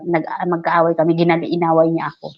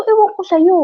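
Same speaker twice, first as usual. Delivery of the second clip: starts high, mock dismissive; sassy brush-off; 12 semitones higher, 5 dB louder than usual. Playing dumb to the coworker about the phone, sassy. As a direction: shrug it off, feigning innocence. Her retold retort.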